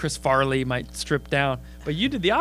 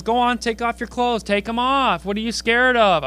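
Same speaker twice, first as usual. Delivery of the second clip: higher, taunting dare; taunting heckler; 7 semitones higher, 5 dB louder than usual. Retold or imitated. Drunk girl daring the comic, taunting. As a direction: egg him on like a drunk heckler. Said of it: "She goes".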